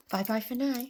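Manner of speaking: plain reading voice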